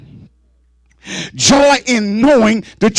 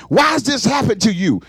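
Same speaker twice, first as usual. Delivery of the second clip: ordinary talk, nothing marked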